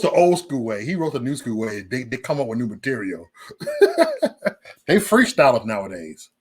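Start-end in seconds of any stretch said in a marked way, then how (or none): 3.5-4.5 high laugh. Real, not acted. Laughs at roasting talk.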